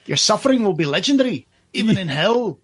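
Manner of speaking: put-on a terrible, half-hearted Scouse accent as Pinhead; a quick, rough stab at a menacing Scouse accent. Podcast host imitating Doug Bradley's Scouse Pinhead, weak Scouse accent. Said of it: "That was just a terrible imitation that I did there".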